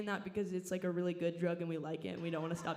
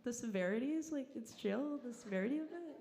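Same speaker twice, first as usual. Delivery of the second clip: confused self quote; 6 semitones higher than usual. Retold or imitated. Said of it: "I'm like".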